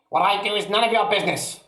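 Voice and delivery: high fast sour grandma voice; snapping old woman. A narrator's reading. Grandma rebuffing George, high and fast. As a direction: snap it like a nasty old hag. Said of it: "the old woman said".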